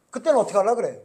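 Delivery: ordinary delivery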